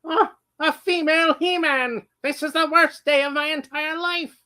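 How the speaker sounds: high whiny skeleton villain wail; Skeletor impression. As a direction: melodramatic villain complaint. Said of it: "I can do my Skeletor impression".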